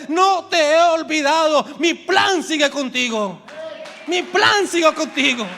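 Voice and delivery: high, loud preaching declaration; shouted promise. Acted. God shouts his promise to the repentant listener. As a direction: proclaim it with full force.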